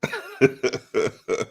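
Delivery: high burst of laughter; laughing. Real, not acted. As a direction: crack up with a long, high laugh. Laughs after showing the coach's look.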